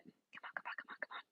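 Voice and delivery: hushed impatient whisper. Real, not acted. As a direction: breathe it, willing the internet on. Urging slow internet along.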